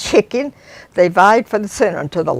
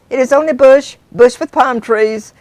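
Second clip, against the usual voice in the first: driver dismissing; 6 semitones higher, 6 dB louder than usual. Acted. Driver dismisses her request.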